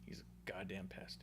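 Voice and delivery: hushed, breathless whisper. Real, not acted. Host hushing his verdict on K O, whispered. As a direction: whisper it like a secret. Fond exasperated remark whispered.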